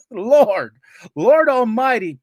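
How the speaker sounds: loud, high cry to heaven; hammed-up exasperated invocation; comic desperate plea. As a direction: wail it like a prayer for relief. Comic plea through pain.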